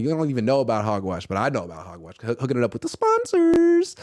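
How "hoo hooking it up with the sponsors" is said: excited. Real, not acted. Excited shout-out.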